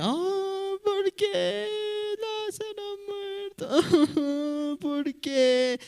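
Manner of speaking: high, very slow wailing lament; exaggerated high weeping voice; mock crying sister. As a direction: wail it like a grieving sister, hammed up for kids. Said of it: "Estaba llorando".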